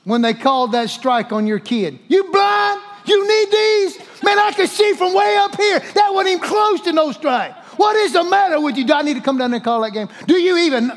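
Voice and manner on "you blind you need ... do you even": outraged sports parent hollering; shouting. Acted. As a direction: yell at the umpire from way up in the stands. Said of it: "Tell me you don't know how to shout".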